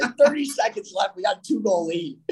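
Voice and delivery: high-pitched mock exasperation; retold incredulous retort. Retold comeback to cautious teammate.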